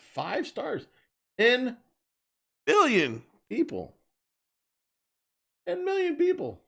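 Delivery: high, squealing fake awe; mock amazement. Vlogger mocking the phone's hype ratings, high mock amazement. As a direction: gush over it like a gullible buyer.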